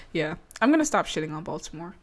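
ordinary delivery

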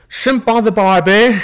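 slow, high, loud mock Aussie drawl; bad Australian accent. Urged to keep trying, host does a bad Australian accent. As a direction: ham up a clumsy Australian line. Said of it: "Keep trying".

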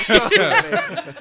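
giggling, stretched-out so many; laughing. Real, not acted. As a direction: laugh the words out in disbelief. Laughing repeat of title complaint.

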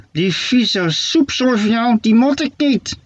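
high, slow mock-shouting witch voice. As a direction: snarl it out as a cranky old witch, drawn out. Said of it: "schreeuwde Steketee". (hoog, langzaam heksenstemmetje dat schreeuwt)

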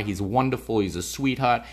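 usual speaking voice

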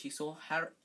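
plain reading voice

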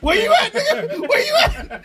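high, loud, panicked yelling; laughing high panicked holler; frantic shout. Retold or imitated. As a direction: yell it like you're scrambling to find him. Retold frantic questioning.

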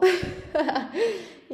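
real loud laugh, high; cracking up at the ghost story; burst of laughter. Real, not acted. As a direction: laugh out loud at the spooky twist. Burst of laughter after ghost reveal.

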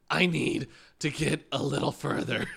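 loud, insistent dog's voice; mock urgency, punching the words; imitated eager dog. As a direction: speak up as a pushy dog. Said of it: "he's like".

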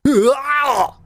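high, strangled "ah" noise; exaggerated honking cry; imitated sneezing outburst. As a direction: mimic a man choking on a sneeze. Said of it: "he starts going".

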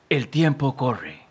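slow, drawn-out film title, falling at the end; dramatic title read. Pretend. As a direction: announce it like a solemn movie title. Título de la película premiada.

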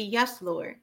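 ordinary talk, nothing marked

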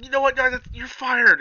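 high, loud diva firing; high mock voice. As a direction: fire them in a squeaky voice. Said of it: "it's like".

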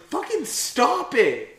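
loud, high acted annoyance; hammed-up fed-up yell; exasperated scolding. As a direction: snap at someone pestering you. Acted scolding of Yusuke at computer.

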